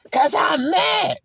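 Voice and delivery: high, drawn-out mock shout; mad yell. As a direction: yell back as the angry person. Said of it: "Why you gotta yell?".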